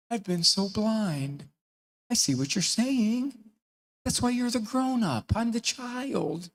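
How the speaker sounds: high, slow, drawn-out mock revelation; syrupy slow wonder, pitched high; kid's mock epiphany. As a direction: play a child suddenly enlightened, hammed up. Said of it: "if a kid, after three months".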